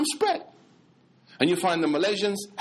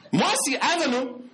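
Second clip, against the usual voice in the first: high, disbelieving repeat; incredulous echo; 8 semitones higher than usual. Retold or imitated. Speaker doubting the convert's accent, high-pitched and incredulous.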